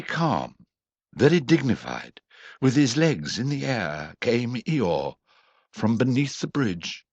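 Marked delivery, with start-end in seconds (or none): none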